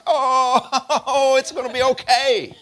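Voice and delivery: big, high belly-laugh through the words; loud, hammed-up chuckling comfort; laughing reassurance. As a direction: laugh it off like a jolly granddaddy. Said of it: "laughing with us like you, like a, like a granddaddy".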